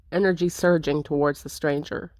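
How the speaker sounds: ordinary talk, nothing marked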